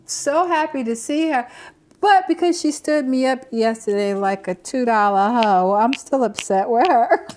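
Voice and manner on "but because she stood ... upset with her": mock indignant. Complaining about being stood up.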